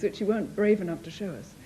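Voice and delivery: ordinary talk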